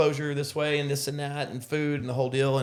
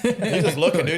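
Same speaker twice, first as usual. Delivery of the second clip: laughing; 5 semitones higher, 7 dB louder than usual. Real, not acted. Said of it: "laughing while telling".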